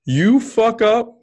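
high, loud, slow retold yell at a kid; berserk yell. Father yelling at his crying son, loud and high. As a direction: go berserk on the words, pitch way up. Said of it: "I will go berserk".

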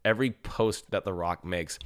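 usual speaking voice

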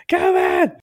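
a hammed-up high squeal of get away; mock rude lad. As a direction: push the dismissal to a ridiculous peak. Mocking line repeated after laugh.

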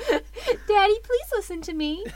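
fish woman voice. Acted. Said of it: "do the voice".

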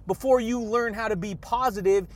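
normal talking voice

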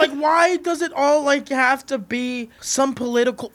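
high, slow, loud rant; exasperated shout. Real, not acted. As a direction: push it out in frustration. Passionate rant about comedy.

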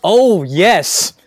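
loud, thrilled "oh yes"; excited shout. Real, not acted. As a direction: shout it with delight. Excited recognition of old shoes.

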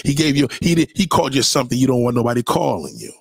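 ordinary delivery

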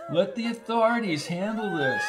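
high-pitched appeal; pleading. A narrator's reading. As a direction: plead with him to hold back. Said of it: "pleaded the Reverend".

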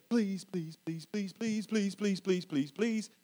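put-on soft, whiny nine-year-old pleading; whiny kid begging. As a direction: beg like a kid after candy, hushed. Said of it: "he'll come and he'll say".

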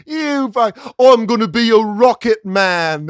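high, loud hammed-up singer line; mock singer. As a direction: ham it up as a pompous star.

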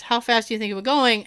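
rapid-fire, words run together fast; talking fast. Retold or imitated. Officer firing a question at the driver, fast. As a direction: rattle the question off quickly. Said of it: "So he was talking fast".

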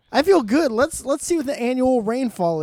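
gleeful. Acted. Gleeful after winning argument.

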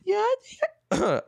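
put-on high girly voice, breaking off; man doing a woman's voice. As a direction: squeak out a falsetto girl voice. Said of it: "I can't even do my girl voice anymore".

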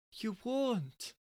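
high soft firm denial; high quiet "won't"; firm wife. Acted. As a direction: put your foot down quietly. Quarrel, wife refusing.

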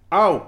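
loud, high "Au!" of pain; loud, high shriek of pain; pained yelp. Acted. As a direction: shriek it like a fussy, hysterical poodle. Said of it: "nuffig tikje hysterisch poedeltje".

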